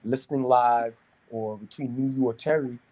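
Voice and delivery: ordinary talk, nothing marked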